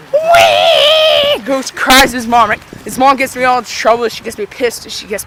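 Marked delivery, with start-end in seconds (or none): 0.1-1.4 put-on very high squealed kid wail; exaggerated childish bawl; mock crying wail. Punched cousin cries to his mom, mock high wail.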